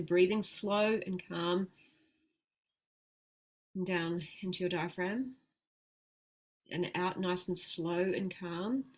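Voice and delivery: slow, soft, calming guidance. Shown as a demonstration. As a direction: ease them into calm breathing. Models the calm breathing she describes.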